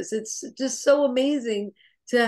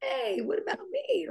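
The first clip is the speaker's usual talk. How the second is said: high whispered "Hey, what about me?"; breathy, hopeful little aside, pitched way up; eager prospect; 11 semitones higher, 6 dB quieter than usual. Retold or imitated. Prospect realizing the offer is for them, high whisper. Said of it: "they're going".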